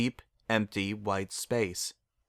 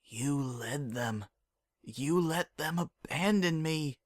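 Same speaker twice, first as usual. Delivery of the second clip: raspy accusing voice; 5 semitones higher, 4 dB quieter, slower than usual. Acted. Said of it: "made raspy from dehydration".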